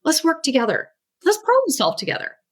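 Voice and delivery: put-on rally the child warmly, like a teammate; reassuring parent. Parent joining arms with the child, bright and reassuring. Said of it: "you're joining arms going".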